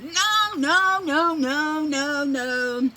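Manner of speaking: very high, loud string of no; frantic take-it-back; horrified by a bad Spanish line; panicked denial. Horrified at saying hooker line.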